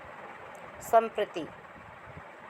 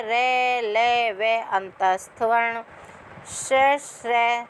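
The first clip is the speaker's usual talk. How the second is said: letter recitation; slower than usual. Shown as a demonstration. Teacher reciting Sanskrit consonant rows to students, in a measured drill. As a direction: chant the consonant rows syllable by syllable.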